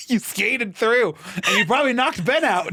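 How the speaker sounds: high, exclaimed accusation through laughter; giddy, high-pitched indignation; laughing outrage. Real, not acted. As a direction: cry out in amused disbelief.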